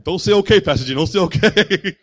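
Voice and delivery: half-yell it like the locals correcting him; mock scolding. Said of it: "they're like".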